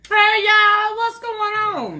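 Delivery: high, loud, drawn-out hello; big sing-out show opener; hollered greeting. Real, not acted. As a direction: call out to the whole audience.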